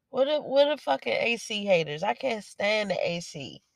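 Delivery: usual speaking voice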